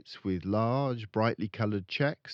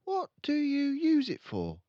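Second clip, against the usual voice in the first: put-on much higher, curious child voice; little girl; 3 dB louder than usual. Matilda asking her father, curious child voice. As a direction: ask it like an innocent girl. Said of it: "Matilda asked him".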